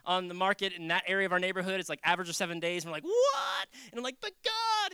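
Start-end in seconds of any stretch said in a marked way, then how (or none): 3.1-3.7 loud, very high yelp of disbelief; incredulous, pitched way up; shocked disbelief. Retold or imitated. Acts out shock at bad news. 4.2-4.9 high-pitched whine of objection; exasperated acted plea; pleading protest. Acts out protest to God.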